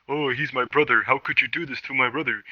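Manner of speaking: mock reproach. Best friend scolding him over his new friend, mock reproach. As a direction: lightly mimic a wronged friend's protest.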